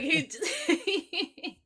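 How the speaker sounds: ordinary talk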